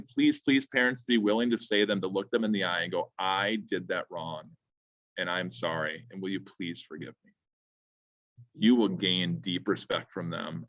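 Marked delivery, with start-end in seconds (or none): 3.2-7.3 sincere apology. Shown as a demonstration. Parent apology modelled for parents.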